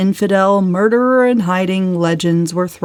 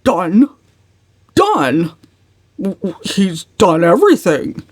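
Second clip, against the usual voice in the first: Uncle Wellington, flustered. A narrator's reading. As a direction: splutter it back at her in disbelief. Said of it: "Uncle Wellington stared at her".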